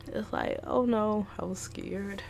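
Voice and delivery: ordinary talk, nothing marked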